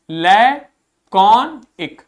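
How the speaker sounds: लैकॉनिक drawn out very slowly, each syllable held; slow model reading. Shown as a demonstration. Teacher reading a new word to students, very slow and careful. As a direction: stretch लैकॉनिक out for the class to copy. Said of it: "अगला देखो क्या लिखा है".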